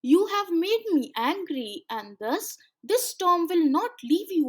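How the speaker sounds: loud, high angry scolding. Acted. Sea guardian scolding children for polluting, angry and loud. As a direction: scold them with rising fury. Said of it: "said the seaman in anger".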